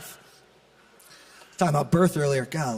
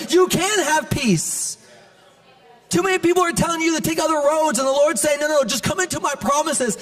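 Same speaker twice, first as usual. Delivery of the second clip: impassioned preaching; 7 semitones higher than usual. Real, not acted. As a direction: preach it up high, hammering each repeated phrase. Preaches encouragement at full force.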